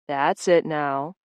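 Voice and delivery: slow, low and gentle coaxing. Acted. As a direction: soothe her along slowly. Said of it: "Vance coaxed Lorraine".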